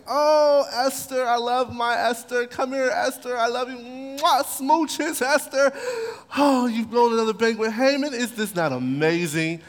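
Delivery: high, gushing lovestruck king; doting king. Retold or imitated. King gushing over his beloved queen at the banquet, high and doting. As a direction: coo over her like a besotted king.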